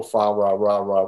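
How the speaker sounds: "fa ra ra ra" with an R sound in place of each L. Retold or imitated. Explaining the carolers' missing L, "fa ra ra ra" with R for L. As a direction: quote "fa ra ra ra", every L turned to R. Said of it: "there's no L in the Chinese language".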